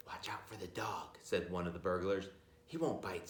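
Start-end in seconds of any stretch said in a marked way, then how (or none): 0.1-1.2 much softer, a sneaky warning; hushed burglar. Acted. 2.7-3.3 much softer, dismissive; hushed burglar. Acted. Burglars sneaking in quietly.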